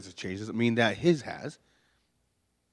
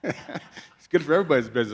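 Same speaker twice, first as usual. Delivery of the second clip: chuckling through the words; amused, laughing aside to the congregation; 6 semitones higher than usual. Real, not acted. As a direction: laugh at your own joke as you say it.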